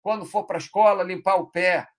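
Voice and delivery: exasperated parent scolding; mock scolding parent. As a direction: nag the kid hard. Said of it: "O cara pode tá brigando".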